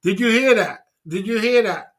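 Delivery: high, loud, insistent repeats; insistent exclaiming. Real, not acted. Triumphantly repeats after the played clip.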